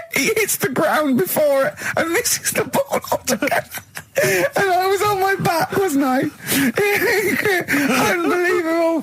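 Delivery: very high, squealing, barely able to talk; helpless laughter. Real, not acted. Host telling of friend missing the ball, laughing helplessly. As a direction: wheeze the words out through hysterical laughter.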